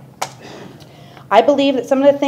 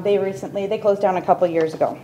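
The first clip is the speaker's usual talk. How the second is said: ordinary talk, nothing marked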